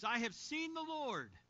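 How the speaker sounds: slow and loud, lifted high with wonder; joyful announcement. Acted. Mary telling the disciples she saw the Lord, slow joyful cry. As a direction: proclaim it like news too good to hold.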